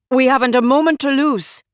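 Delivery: shout. A narrator's reading. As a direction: shout it in a rush to the others. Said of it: "Amarnath shouted".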